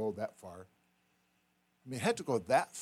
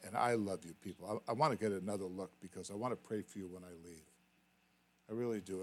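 ordinary delivery

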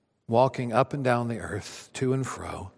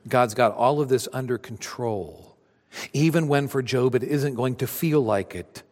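ordinary delivery

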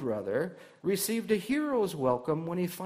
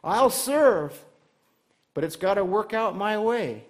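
grudging servant; 4 semitones higher, 7 dB louder than usual. Retold or imitated. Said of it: "voicing a proud servant's attitude".

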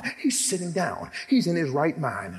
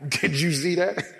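louder, laughing through the words; laughing disbelief; 4 dB louder than usual. Acted. James to John on the boat, laughing amazed.

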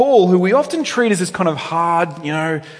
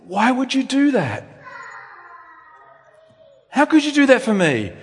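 overwhelmed wonder; 9 semitones higher than usual. Pretend. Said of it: "a trembling of trust that says".

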